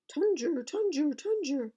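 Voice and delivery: high, loud tunger tunger chant; rolling chant. Acted. Little pot rolling to the palace, high chant. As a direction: sing the rolling sound of the pot.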